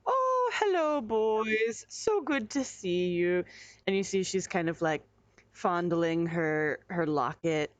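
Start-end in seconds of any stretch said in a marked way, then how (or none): 0.0-3.5 high sugary hostess voice; swooping falsetto welcome; Bertrude greeting. Acted. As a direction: coo it like a gracious innkeeper. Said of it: "Bertrude is like".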